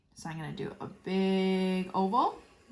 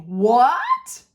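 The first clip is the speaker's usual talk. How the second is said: "What?" blurted much louder and higher; 4 semitones higher, 12 dB louder than usual. Shown as a demonstration. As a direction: shout "What?" in capital letters. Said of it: "you know that he is saying it loudly".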